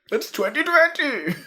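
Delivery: high ghostly Santa; spooky Santa delivering bad news; Dead Santa voice. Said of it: "impression of Dead Santa".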